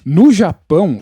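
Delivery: ordinary delivery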